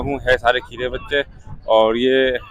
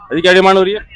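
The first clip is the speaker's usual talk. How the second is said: raised voice, high and loud; calling out; 7 semitones higher, 8 dB louder, much faster than usual. Real, not acted. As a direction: call across the market. Calling out to a seller.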